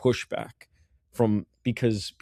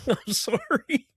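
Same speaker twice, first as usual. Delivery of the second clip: high-pitched mock apology; playfully pleading, pitched way up; 7 semitones higher, slower than usual. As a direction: apologize in a squeaky, put-on high voice.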